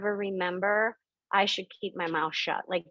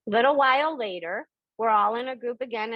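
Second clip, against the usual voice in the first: ordinary delivery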